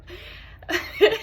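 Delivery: high, breathless burst of laughter; giggling fit; helpless laugh. Acted. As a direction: laugh as if unable to stop. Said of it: "I could not stop laughing".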